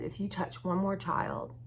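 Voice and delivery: quiet retold threat. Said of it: "basically said".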